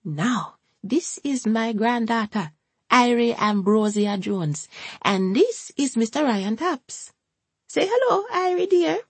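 slow, then high coaxing Jamaican gran; Hortense introducing. A narrator's reading. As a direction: present the girl slowly, then coax her up high. Hortense introduces her granddaughter.

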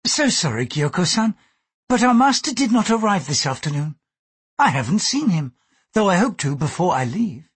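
courteous refusal with a lifted pitch; lightly voiced Mariko, polite.

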